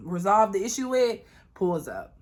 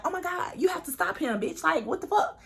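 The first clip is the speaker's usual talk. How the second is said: higher, pleading friend voice; higher, frantic plea; panicked friend; 8 semitones higher than usual. Retold or imitated. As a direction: beg her to stop him, in a higher voice.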